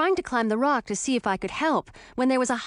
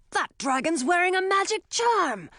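higher alarmed shout; 8 semitones higher than usual. A narrator's reading. Townsman warns the crowd about the charm, shouting. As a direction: cry it out to the crowd. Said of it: "shouted another townsman".

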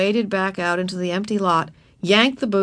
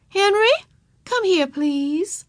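high, drawn-out polite summons; calling someone over; 12 semitones higher, slower than usual. A narrator's reading. Teacher calling the traffic boy over, polite summons. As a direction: beckon him with a slow call.

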